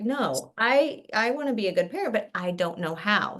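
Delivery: a hint of a parent asking for help; earnest parent voice. Doctor to students, voicing a worried parent, pleading.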